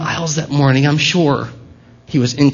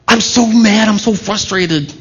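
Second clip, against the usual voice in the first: mock frustration; 7 semitones higher than usual. New believer retold complaining.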